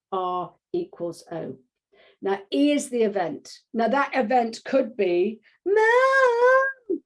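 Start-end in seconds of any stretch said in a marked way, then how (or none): 5.6-7.0 put-on high, drawn-out child's call for Mom; whiny child calling Mom. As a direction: wail it like a needy kid. Child calling parent as event.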